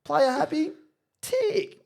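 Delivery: very high singled-out questions; high comic coach impression; imitating the coach. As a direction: tick off a checklist in a squeaky coach voice. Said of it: "He goes".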